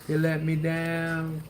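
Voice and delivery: slow, drawn-out playful lament over the pack; lightly hammed-up sorrow; mock heartbreak. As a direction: sulk it out slowly like a jilted lover. Mock heartbreak at a bad pull.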